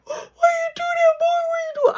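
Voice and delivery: high, loud wailing sob; boo-hooing. Retold or imitated. Shot by his brother, sobbing at him. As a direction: wail at your brother through tears. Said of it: "I'm crying. I'm boo-hooing".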